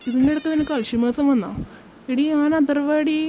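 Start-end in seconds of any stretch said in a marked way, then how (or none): 2.1-3.2 "അഥർവ്വടി" said slowly and pointedly, pitch raised; correcting his name. Acted. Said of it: "പേരും ഇപ്പം മറന്നുപോയാ നീ". (പേര് തിരുത്തി "അഥർവ്വടി" പതുക്കെ ഊന്നി പറയുന്നു)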